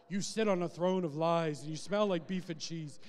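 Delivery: quoting the Elf scene with a mock accusing edge; Elf movie quote. Preacher quoting Elf to mock an angry God, mock accusing. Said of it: "like the fake Santa Claus and Elf".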